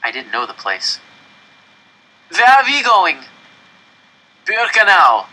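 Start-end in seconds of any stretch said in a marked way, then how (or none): 2.3-3.2 high, loud shout in another voice; calling out. A narrator's reading. The stranger asking again across the tracks, loud shouting. 4.5-5.3 high, loud shout of the name; calling out. A narrator's reading. The man answering where his train goes, shouted. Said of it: "the man in the other train called".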